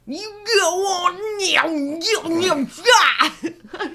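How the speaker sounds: put-on high, loud mumbled hillbilly accent; unintelligible backwoods accent. Host mocking the neighbor dad in a garbled backwoods accent. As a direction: mangle the words in a thick backwoods accent. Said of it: "the way he says it is so unintelligible".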